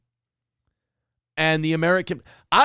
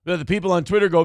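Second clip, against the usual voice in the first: ordinary delivery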